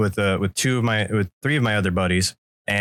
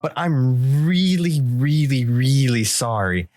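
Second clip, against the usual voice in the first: pleading apology; 3 semitones higher than usual. Retold or imitated. Buddy apologising for the shovel, pleading. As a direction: overdo the sorry. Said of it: "And he goes".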